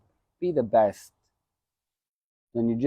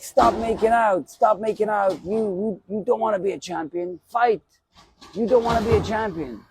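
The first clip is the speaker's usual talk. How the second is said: coach yelling; 11 semitones higher than usual. Retold or imitated. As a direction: bark at the fighter to stop clinching and fight. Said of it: "And he's like".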